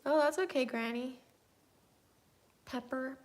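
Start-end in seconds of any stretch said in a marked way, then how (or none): none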